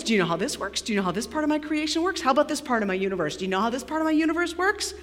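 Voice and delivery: soft, very fast rising questions; rapid-fire quizzing. Acted. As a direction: pile up questions without a breath. God rapid-fires questions at Job.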